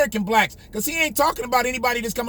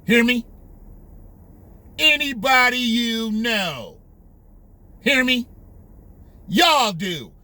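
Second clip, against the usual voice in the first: mildly contemptuous imitation, punchy and drawn out; mock a politician talking down, with vigor; Joe's contempt; slower than usual. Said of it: "Joe spoke with contempt in his voice".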